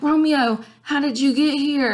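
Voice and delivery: put-on high, surprised girl voice; teacher voicing Juliet, amazed to see Romeo. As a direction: ask it up high like a startled Juliet. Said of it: "Juliet's like".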